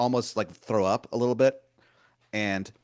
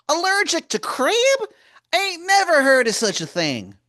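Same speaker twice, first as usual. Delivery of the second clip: much higher and louder, a sweet-talking wizard calling you hun; Baltimore wizard voice; 9 dB louder than usual. Acted. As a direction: wheedle like a pushy old wizard begging a favor. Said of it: "He goes".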